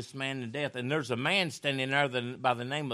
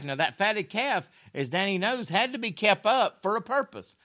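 ordinary talk, nothing marked

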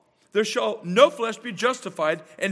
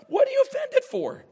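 pitched-up exasperated preacher rebuke; exasperated shout; 9 semitones higher than usual. Retold or imitated. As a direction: call out the offended churchgoers. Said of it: "I'm like".

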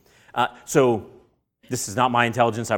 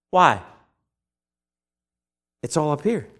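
ordinary talk, nothing marked

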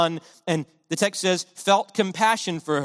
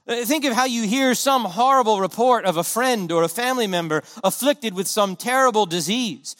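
ordinary talk, nothing marked

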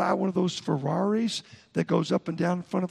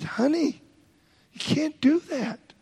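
gentle scold; 7 semitones higher, slower than usual. Retold or imitated. Husband scolds his wife gently.

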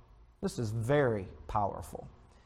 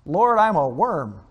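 loud, raised cry to God; 3 semitones higher, 9 dB louder than usual. Retold or imitated. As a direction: cry it out loudly, quoting a humbled psalmist. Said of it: "cried out".

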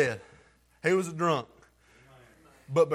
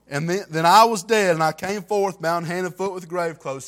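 louder, rising testimony; building preaching; 8 dB louder than usual. Acted. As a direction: let it swell into preaching.

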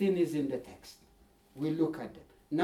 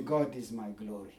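hushed confession; 9 semitones lower, 7 dB quieter than usual. Retold or imitated. David's quiet confession quoted.

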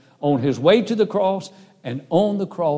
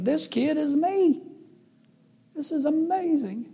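amazed inner thought; 11 semitones higher, 3 dB quieter than usual. Retold or imitated.

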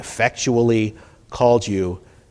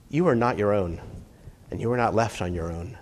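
soft, low pastoral assurance; gently, low and hushed; quiet reassurance; 4 semitones lower, 8 dB quieter than usual. Real, not acted. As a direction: lower your voice and reassure them. Reassures the congregation softly.

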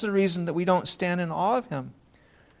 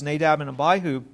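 normal talking voice

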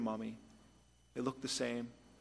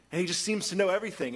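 ordinary talk

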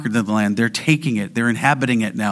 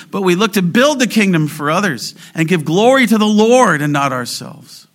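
high, loud, urgent pulpit delivery; impassioned sermon climax; preaching at full force; 9 semitones higher, 6 dB louder than usual. Acted. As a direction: drive the warning home to the church. Preaching on Jesus' command to watch.

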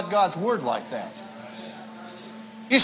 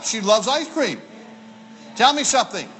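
ordinary delivery

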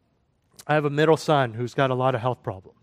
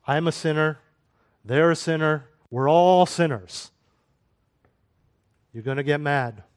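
ordinary delivery

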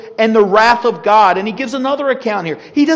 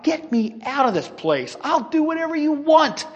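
pretend pleading; 6 semitones higher than usual. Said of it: "Paul would be crying out".

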